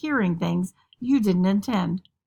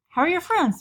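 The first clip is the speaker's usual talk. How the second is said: higher, sing-song parental questioning; prying mom; 8 semitones higher than usual. Acted. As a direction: play an eager mom quizzing her kid. Acted probing mother questions her teen.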